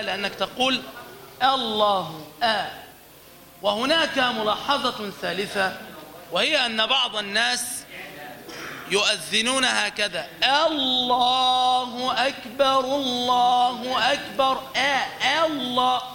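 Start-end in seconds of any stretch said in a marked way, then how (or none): 1.4-2.7 "الله" begun on a light, thin "آ"; slow "الله" with a front, unthickened first vowel; light hamza. Shown as a demonstration. Teacher states the second rule, models light hamza. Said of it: "نقوم بترقيق الهمزة عند نطقها". 10.7-16.0 slow higher chant of "الله أكبر" with an overdone thin "آ"; exaggerated, forced hamza. Shown as a demonstration. Teacher mimics muezzins who overdo it, forced thin hamza. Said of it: "هذه مبالغة".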